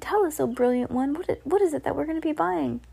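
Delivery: high, pleading question; excited followers pestering their leader; eager cry. A narrator's reading. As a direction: beg high to be told. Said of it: "they cried".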